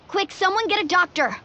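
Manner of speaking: high, alarmed call for help; urgent plea. Acted. Friend is injured, urgent call for help. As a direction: call out urgently to anyone near.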